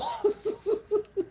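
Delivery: high amused cackle; high cackling laugh. Real, not acted. Laughing at own story.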